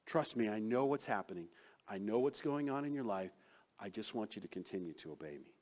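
soft, gentle voice of Jesus; humble reassurance. Acted. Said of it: "Jesus humbly comes to us and says".

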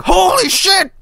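very high falsetto outburst; mock panicked exclamation. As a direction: imitate a character yelping in falsetto. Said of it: "Instead of just going".

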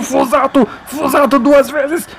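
high, stretched commentator cry; excited save call. Real, not acted. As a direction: hype the keeper's double save. Celebrating a double save. (narração empolgada da defesa)

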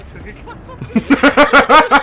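high, slow, loud disbelief; incredulous. Real, not acted. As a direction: stretch it out in disbelief. Disbelief at voodoo class.

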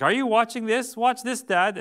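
put-on high, eager child voice; excited kid. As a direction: show off to Dad like a proud little kid. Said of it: "It's like".

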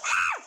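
very high, short squeal; startled scream. Real, not acted. Gamer jumping at a zombie scare, high squeal. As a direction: yelp sharply in a high pitch.

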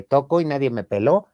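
normal talking voice

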